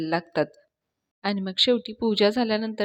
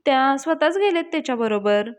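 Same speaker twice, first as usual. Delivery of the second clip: interrupting landlord; 7 semitones higher than usual. Retold or imitated. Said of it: "मध्येच म्हणायला लागतो".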